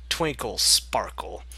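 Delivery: a quick unvoiced whisper of the name. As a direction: breathe the name out, barely voiced. Nervous pony slipping away.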